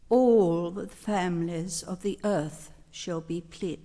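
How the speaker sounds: normal talking voice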